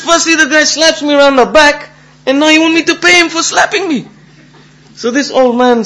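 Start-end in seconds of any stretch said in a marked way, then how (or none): 0.0-4.1 high, loud, indignant complaint; outraged protest. Acted. Slapped man told to pay his attacker, outraged. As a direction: protest the injustice at a raised pitch.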